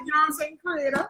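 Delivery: normal talking voice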